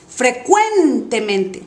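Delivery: 'frecuentemente' slow, CUEN stretched long; exaggerated stress. Shown as a demonstration. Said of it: "voy a exagerar primero". ('frecuentemente' lento, con CUEN exagerado)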